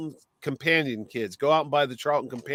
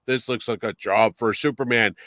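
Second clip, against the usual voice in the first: drops lower into a hero voice; Clark to Superman voice change; 4 semitones lower than usual. Retold or imitated. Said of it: "his voice would change".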